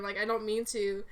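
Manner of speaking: ordinary talk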